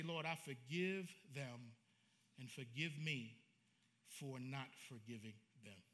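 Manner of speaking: slow, soft prayer of forgiveness; model prayer. Shown as a demonstration. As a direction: say it slowly and softly, like a real prayer. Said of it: "go home and do it and say".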